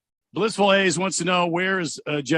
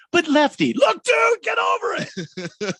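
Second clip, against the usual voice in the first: mock blow-up shout; 12 semitones higher, 3 dB louder, slower than usual. Lefty snapping at a pushy reporter, loud mock shout. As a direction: pitch jumps way up, loud and fed up.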